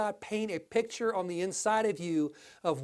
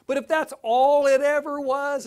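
emphatic preaching; 5 semitones higher, 7 dB louder than usual. Real, not acted.

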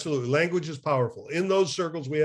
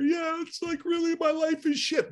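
much higher, self-pitying whine to the bartender; mock bar-stool lament; 13 semitones higher than usual. Crying into a drink to the bartender, high mock whine. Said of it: "almost like crying on the".

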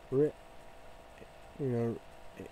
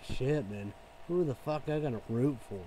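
ordinary delivery

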